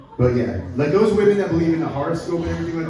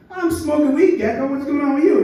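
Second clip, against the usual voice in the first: higher, louder goofy stoner voice; stoned monkey; 9 semitones higher, 5 dB louder than usual. Acted. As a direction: play a chill stoner monkey.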